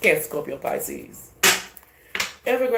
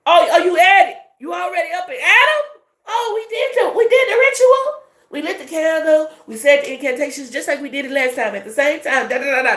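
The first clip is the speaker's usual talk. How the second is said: very high, loud mocking shriek; mock plotters; 10 semitones higher, 6 dB louder than usual. Plotters panicking that their ritual failed, high mocking shriek. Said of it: "They say".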